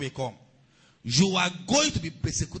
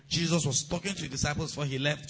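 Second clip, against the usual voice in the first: usual speaking voice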